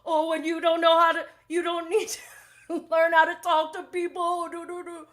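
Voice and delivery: high, loud mimicking voice; hammed-up taunt trailing into nonsense syllables; mocking retort. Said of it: "He's like".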